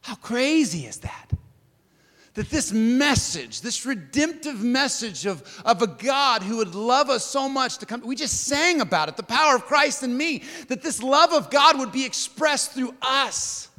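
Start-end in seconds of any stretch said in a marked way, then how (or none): 2.3-13.7 high, driving sermon delivery; emphatic, stretched key words; impassioned preaching. Real, not acted. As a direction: preach it with rising fervour, pitch held up. Preaches the gospel message.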